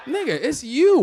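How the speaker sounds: ordinary talk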